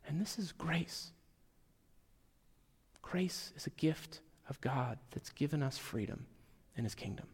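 breathy hush on grace; soft closing whisper to the church. Real, not acted. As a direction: whisper it like a secret. Closing hushed reflection on grace.

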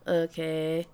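flat monotone okay. Retold or imitated. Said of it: "in her monotone voice".

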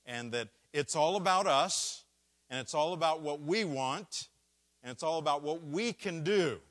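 high, loud, building list; preacher rising to a peak. Real, not acted.

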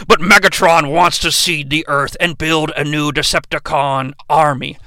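low, solemn robot-commander voice; robot leader. Retold or imitated. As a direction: intone it deep, like a mock-epic hero. Voicing Optimus Prime in retelling.

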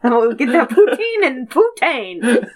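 high laughing Canadian voice; hammed-up Canadian accent, laughing. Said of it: "in the Canadian accent".

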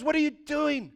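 high-pitched, exasperated mock outburst; upset. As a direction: demand it in comic disbelief, pitch up. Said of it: "Balak gets so upset".

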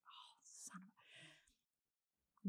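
hushed whisper of a swallowed curse. Retold or imitated. To herself on hearing the dog is gone, whispered. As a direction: mutter it under the breath, unvoiced. Swearing under breath at lost dog.

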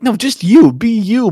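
imitated pleading insistence; retold exasperation. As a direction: act out an impatient correction. Retold exasperated correction of actor.

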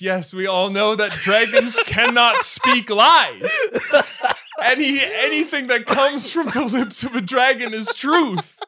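loud, high, hammed-up declaration; mock-epic character voice, laughing at the edges; skunk proclaiming. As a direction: proclaim it with overblown dramatic conviction. Acted skunk's grand proclamation.

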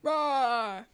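loud playful bear roar. Pretend. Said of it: "That's me as a bear".

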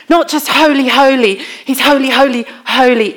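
high, loud "holy, holy" hammered home; rising sermon emphasis on "holy"; emphatic proclamation. Shown as a demonstration. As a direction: preach each "holy" with force. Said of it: "a category beyond a category".

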